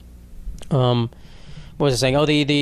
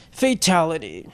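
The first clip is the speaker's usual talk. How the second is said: Mortal Kombat announcer; slower than usual. Pretend. Calling the kill in Mortal Kombat, announcer voice. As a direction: announce the finishing move like the arcade game. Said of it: "like Scorpion".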